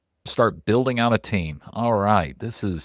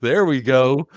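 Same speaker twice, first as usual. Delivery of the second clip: impressed surprise; 5 semitones higher, 3 dB louder, slower than usual. Retold or imitated. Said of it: "And I was like".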